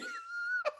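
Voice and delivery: short high burst of laughter; high-pitched giggle. Real, not acted. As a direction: break into a squeaky laugh. Laughing at own point.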